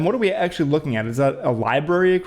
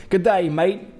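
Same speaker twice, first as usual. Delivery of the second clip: bad Australian accent; 4 semitones higher than usual. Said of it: "not a good Australian one".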